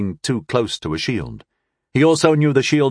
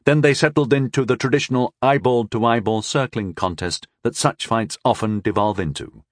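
ordinary delivery

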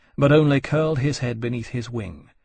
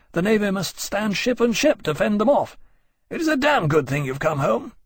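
higher-pitched, brisk reporting officer; lightly voiced earnest officer; 9 semitones higher than usual. Officer briefs his friend on the blockade, earnest. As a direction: explain it eagerly, pitched up.

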